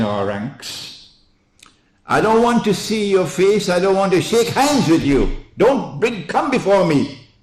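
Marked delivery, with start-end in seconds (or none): 2.1-7.3 loud, high, fast fiery preaching; preaching at full force. Acted. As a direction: thunder it at the congregation. Impassioned sermon climax.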